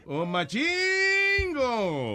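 long, high-pitched drawn-out hail; saludo eufórico. Real, not acted. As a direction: stretch the name out high and slow. Host hails returning caller on air. (saludo eufórico, alargado y agudo)

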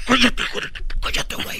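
forceful whispered order; urgent hushing. Acted. Lover covering boy's mouth in closet, urgent whisper. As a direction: whisper it urgently to shut him up.

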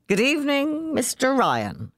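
put-on overly polite drunken greeting; ceremonious. Jim greeting Ryan with drunken ceremony. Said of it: "he added ceremoniously".

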